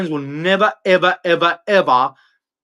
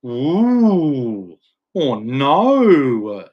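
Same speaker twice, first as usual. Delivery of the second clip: long drawn-out sarcastic "ooh"; mock alarm; 3 semitones lower, much slower than usual. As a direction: feign being scared, stretching each word to taunt. Mock alarm at opponent's anger.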